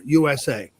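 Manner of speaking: ordinary talk, nothing marked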